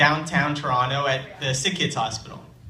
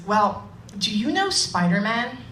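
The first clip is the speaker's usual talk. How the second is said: put-on higher, curious kid question; little boy voice; 8 semitones higher than usual. Storyteller voicing Jerry asking about Spider-Man, higher kid voice. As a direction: ask like an eager five-year-old.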